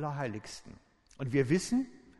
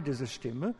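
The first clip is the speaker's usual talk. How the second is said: ordinary talk, nothing marked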